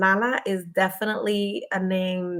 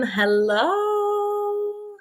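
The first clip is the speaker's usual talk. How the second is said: high greeting; 13 semitones higher than usual. Real, not acted. Greets her brother joining the stream.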